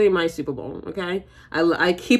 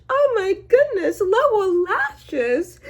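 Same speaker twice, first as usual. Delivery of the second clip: far higher, stretched exclamation; excited squeal; slower than usual. Real, not acted. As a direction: squeal it out in delight. Exclaims at lower lashes.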